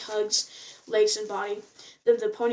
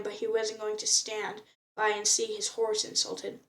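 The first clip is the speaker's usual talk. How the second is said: ordinary delivery, nothing marked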